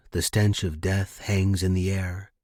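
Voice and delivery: ordinary delivery, nothing marked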